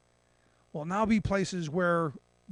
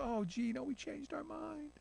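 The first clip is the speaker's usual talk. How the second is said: higher, much softer mock apology; mock sheepish; 8 semitones higher, 10 dB quieter than usual. Host mocking Congress backing out with a soft sheepish voice. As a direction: fake a sheepish retreat. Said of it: "have buyer's remorse and go".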